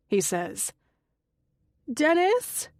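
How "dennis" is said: high shout from another room; shouting a name. A narrator's reading. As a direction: call out to him. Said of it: "Connie shouts from her room".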